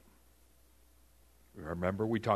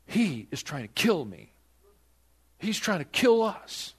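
rising, much louder alarmed cry; the blunt version she never said, louder and higher; panicked outcry; 7 semitones higher, 6 dB louder than usual. Pretend. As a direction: blurt it out in alarm, climbing higher. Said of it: "She doesn't come to this thing and say".

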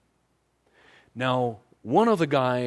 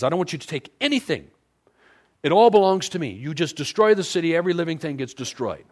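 higher, louder divine command, peaking on it all; commanding God voice; 6 semitones higher, 6 dB louder, faster than usual. Retold or imitated. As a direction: boom out the claim as God. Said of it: "But he says".

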